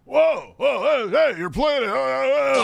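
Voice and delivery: shouted high and loud, hamming it up; a loud, high, overacted startle; over-the-top actor. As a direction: play a ham actor startled on set. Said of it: "he's in actor mode, so he's really over the top".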